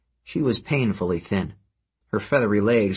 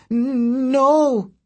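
high, drawn-out harpy wail of protest; stuttered cry; 14 semitones higher, 4 dB louder than usual. A narrator's reading. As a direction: stretch the start, then cry out very high. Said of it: "she stuttered".

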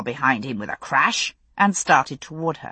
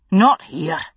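snapped; 4 dB louder than usual. A narrator's reading. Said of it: "he snapped".

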